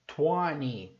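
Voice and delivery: twenty slowly as TWAN-NEE, no second T; American silent T. Shown as a demonstration. Teacher showing American English, twenty without its second T. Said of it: "o T não é pronunciado".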